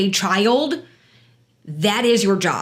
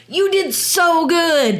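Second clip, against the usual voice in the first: put-on high, drawn-out praise for a child; voiced parent cheering a child on; encouraging praise; 7 semitones higher, slower than usual. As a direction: gush slowly, as if to a little kid. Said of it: "That positive reinforcement".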